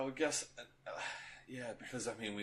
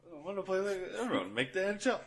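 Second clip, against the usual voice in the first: a higher, fast, laughing go at a movie hockey player; a fan acts out a player's line for his co-host, laughing and higher; 10 semitones higher, faster than usual. Retold or imitated. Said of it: "I think I can do this whole movie".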